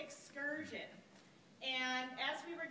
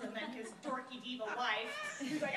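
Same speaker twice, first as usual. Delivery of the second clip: ordinary talk, nothing marked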